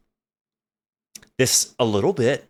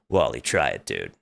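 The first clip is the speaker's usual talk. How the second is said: much lower, mumbled big brother pushing; 6 dB quieter than usual. Retold or imitated. Older brother pressing Wally again, deep and lazy. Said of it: "brother insists".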